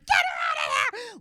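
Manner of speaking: high, loud frantic yell; retold scream. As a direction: shout to get the child out. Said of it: "I was, like, screaming".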